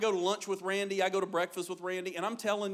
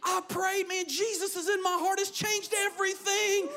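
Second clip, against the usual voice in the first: high, jubilant testimony; imitating a thrilled waiter, high-pitched; excited convert; 11 semitones higher than usual. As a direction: burst out with the great news. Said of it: "He was so excited".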